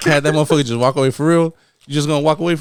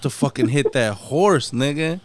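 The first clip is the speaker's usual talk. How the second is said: normal talking voice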